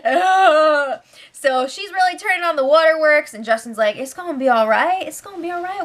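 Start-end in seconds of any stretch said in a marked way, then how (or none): 0.0-1.0 slow, hammed-up fake sobbing; mock sobbing. Shelby fake-crying to Justin, slow mock sob. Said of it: "starts sobbing". 5.1-5.8 soft reassuring. Retold or imitated. Justin comforting crying Shelby.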